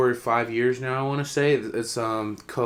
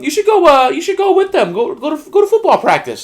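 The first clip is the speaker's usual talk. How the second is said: high-pitched, louder imitation of mom; 9 dB louder than usual. Mom telling her son to go to practice, urging. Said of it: "my mom's like".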